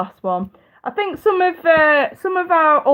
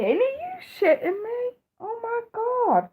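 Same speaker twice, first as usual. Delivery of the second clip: high, exasperated disbelief, almost wailing; 13 semitones higher, 4 dB quieter than usual. Real, not acted. Reacting to a viewer's news of Trudeau, high disbelieving groan. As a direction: throw up your hands at the news.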